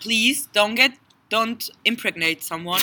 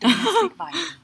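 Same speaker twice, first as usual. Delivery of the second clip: laughing; 9 semitones higher than usual. Real, not acted. Laughing joke.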